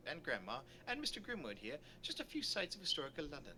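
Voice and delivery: lecturing storybook guide; lilting, formal lecture voice; tour guide character. Acted. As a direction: play a learned guide addressing a group. Voices Doctor Pells on the tour.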